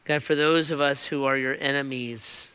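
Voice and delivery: low, soft closing prayer voice. Acted. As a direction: pray quietly over the band. Leads closing prayer over music.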